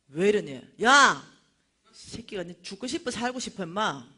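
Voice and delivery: loud bursts of mock menace; charismatic tough mom. Preacher grabbing a drunk man's collar, scolding forcefully. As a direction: confront him with a commanding shout. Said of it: "우리 유진이가 카리스마 짱이래".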